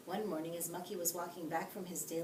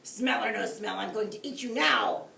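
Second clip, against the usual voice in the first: angry shout; 3 semitones higher, 8 dB louder than usual. A narrator's reading. Wolf attacks Mucky.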